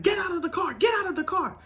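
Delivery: barking an order; mock screaming. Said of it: "he keeps screaming at my mom".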